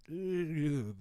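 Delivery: high, drawn-out ew; heebie-jeebies. Acted. Commentator squirms at painfully awkward audio, high creeped-out ew. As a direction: squirm out a grossed-out ew. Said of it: "that feeling like".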